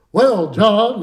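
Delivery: imitating a folk singer's loud, high stage voice; hearty drawn-out announcement to the crowd. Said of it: "you know, his voice".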